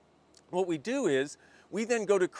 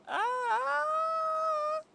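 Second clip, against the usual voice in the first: frustrated high wail. Acted. Acted frustration with process.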